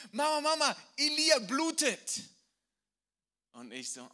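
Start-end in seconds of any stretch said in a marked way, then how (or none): 0.1-2.2 put-on high, loud child calling for mama; frantic child yelling that her brother bleeds; daughter's alarmed cry. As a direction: shout it like a scared little girl.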